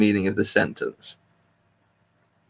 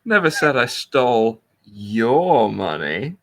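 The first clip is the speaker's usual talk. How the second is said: slow, with "your" set apart and stretched; pause, then lean hard on "your"; stress on YOUR; slower than usual. Shown as a demonstration. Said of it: "You can put the emphasis".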